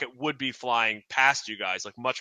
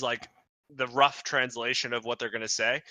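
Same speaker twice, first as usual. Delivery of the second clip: normal talking voice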